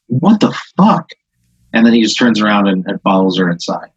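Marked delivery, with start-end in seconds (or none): 0.1-1.1 baffled what the fuck. Acted. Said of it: "he goes".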